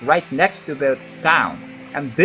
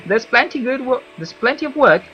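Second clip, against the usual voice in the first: higher, louder elf villager voice; Wood Elf voice; 6 semitones higher, 5 dB louder than usual. Acted. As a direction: give it a gruff elf warning. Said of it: "my Wood Elf voice sounds like that".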